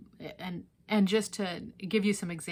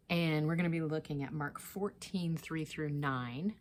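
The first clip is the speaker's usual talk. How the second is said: ordinary talk, nothing marked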